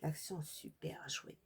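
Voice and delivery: self-mocking whisper. Real, not acted. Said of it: "Oh, l'accent".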